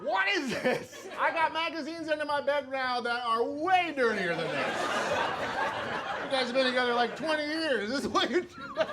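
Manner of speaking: high, incredulous mock outrage; exasperated.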